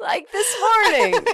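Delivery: high, slow laughing words. Real, not acted. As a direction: laugh through the line.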